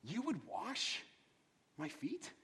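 high, hushed, disgusted disbelief; shocked Peter. Retold or imitated. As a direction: recoil at the thought of it. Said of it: "He's like".

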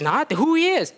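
high cow voice. Acted. Puzzled cow asking his buddy, high surprised voice.

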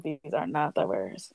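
unvoiced whisper to herself. Telling herself in the crowd, whispered. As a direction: hush it like a secret aside.

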